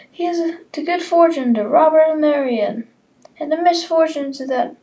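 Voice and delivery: high, cheerful toast with a laugh; merry toasting Robin. Acted. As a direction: Robin toasting the couple in good spirits. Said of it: "said Robin".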